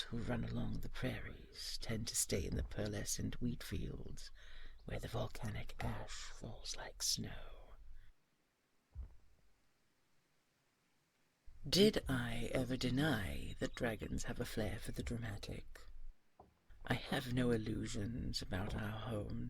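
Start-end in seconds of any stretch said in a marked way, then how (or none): 4.8-15.6 whispered tease. Acted. Dragon teasing his love in a whisper. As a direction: keep it hushed and wry.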